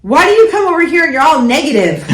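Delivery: high, loud, fast whine through a laugh; mock whiny daughter. Mom mimicking her college daughter's complaint, whiny and high.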